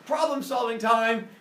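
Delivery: loud, high, slow summons; Father Sky announcing. Acted. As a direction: call the kids over, drawing it out. Father Sky announces to his sons.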